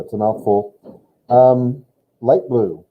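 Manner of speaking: ordinary delivery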